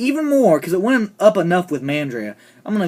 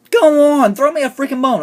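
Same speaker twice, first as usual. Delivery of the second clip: raised, high-pitched fed-up plea; exasperated appeal; 10 semitones higher, 6 dB louder than usual. Real, not acted. Fan appealing to an actress, loud and exasperated. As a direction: protest loudly in disbelief.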